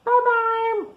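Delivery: a cartoon-high farewell for the kids; squeaky goodbye. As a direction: squeak it out like a tiny puppet. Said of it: "say goodbye".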